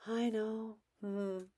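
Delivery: soft, distant flat reply; barely answer, stay detached; brain dead reply. Shown as a demonstration. Parent staying detached with kid, soft flat reply. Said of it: "keep going brain dead".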